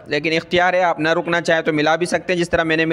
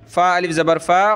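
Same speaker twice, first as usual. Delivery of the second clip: ordinary talk, nothing marked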